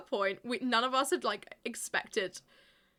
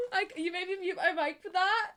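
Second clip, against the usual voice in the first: laughing; 8 semitones higher, 4 dB louder than usual. Real, not acted.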